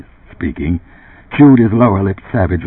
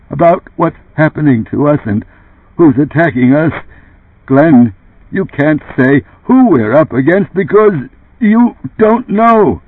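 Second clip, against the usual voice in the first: accusing; 9 semitones higher, 5 dB louder than usual. Acted. Joe confronts Runsider.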